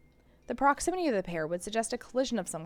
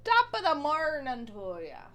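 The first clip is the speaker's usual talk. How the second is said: Irish accent; 4 semitones higher than usual. Shown as a demonstration. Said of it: "I started going into something funky".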